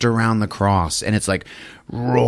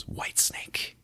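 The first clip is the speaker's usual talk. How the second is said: breathy eerie whisper; spooky whisper; 16 dB quieter than usual. Acted. Podcaster whispering the creepy reveal to friends. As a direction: whisper it like a ghost story.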